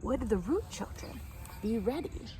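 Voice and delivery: ordinary delivery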